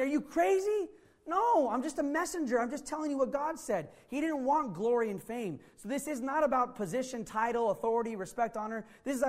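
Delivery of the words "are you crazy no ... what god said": higher-pitched, acted disbelief; incredulous protest. Paul to townspeople who want to worship him, incredulous protest.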